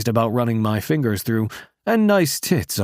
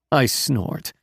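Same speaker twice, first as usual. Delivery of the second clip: ordinary delivery, nothing marked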